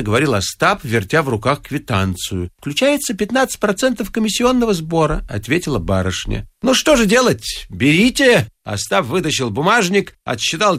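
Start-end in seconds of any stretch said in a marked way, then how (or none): 2.6-5.2 high, even clerk's voice; young lady clerk. A narrator's reading. Clerk answers in lady's voice. 6.6-8.5 resigned payer. A narrator's reading. Ostap resigned, paying.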